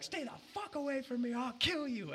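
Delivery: very high, strained mock screaming; mock losing it. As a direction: imitate a raging man without real volume. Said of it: "just losing his mind".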